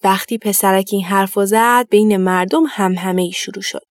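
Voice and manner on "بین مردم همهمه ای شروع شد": usual speaking voice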